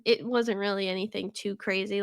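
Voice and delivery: ordinary talk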